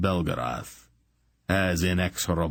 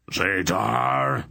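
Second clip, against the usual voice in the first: higher-pitched, sustained roar; 5 semitones higher, 3 dB louder than usual. A narrator's reading. Old sorcerer at the iron door, roaring his enemy's name. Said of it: "Belgarath roared".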